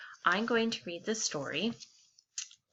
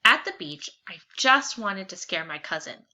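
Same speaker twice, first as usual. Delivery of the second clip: normal talking voice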